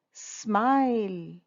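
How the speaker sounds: slow, lengthened word; drawn out. Shown as a demonstration. Teacher revealing the riddle's answer, slowly drawn out. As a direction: stretch the vowel out.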